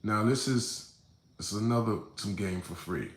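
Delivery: ordinary talk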